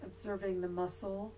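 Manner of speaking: soft, unhurried yoga nidra voice with long pauses; guided meditation. Real, not acted. Yoga teacher leading resting students through body awareness, guided meditation voice. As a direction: guide them gently into body awareness, leaving long silences.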